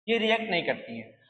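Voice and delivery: ordinary delivery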